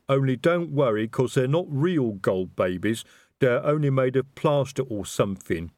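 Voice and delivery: slow, expansive troll explaining; lumbering troll holding forth. A narrator's reading. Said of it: "Detritus's voice in full, expansive explanation".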